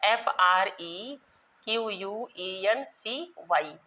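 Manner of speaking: slow, letter-by-letter spelling; dictating the spelling letter by letter. Shown as a demonstration. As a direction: spell each letter slowly so students can copy. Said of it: "of frequency".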